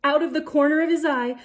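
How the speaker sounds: plain reading voice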